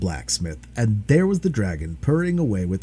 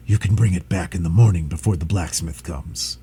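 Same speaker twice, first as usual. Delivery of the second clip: tired dragon; 6 semitones lower than usual. Acted. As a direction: sigh it out, exhausted. Dragon sleepy.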